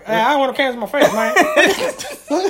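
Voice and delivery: mock the coach, loud and laughing; coach from Howard. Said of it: "you sound like the coach from Howard".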